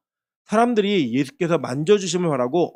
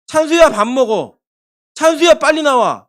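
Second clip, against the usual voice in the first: raised, higher imitated child's call; child calling a name; 5 semitones higher, 5 dB louder than usual. Child casually calling an elder to eat, lightly imitated. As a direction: mimic a child summoning someone, lightly acted. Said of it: "계속 뭐라고 얘기하냐면".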